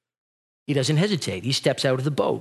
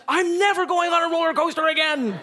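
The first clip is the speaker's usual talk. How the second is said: put-on high, firm child's declaration; a boy swearing off rides; indignant kid; 12 semitones higher than usual. Son after the ride, indignant kid voice. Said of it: "He goes".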